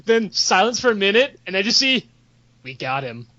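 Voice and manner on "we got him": very low, soft report; understated victory. Acted. Assassin reporting the kill in guild chat, understated. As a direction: drop right down for a terse confirmation.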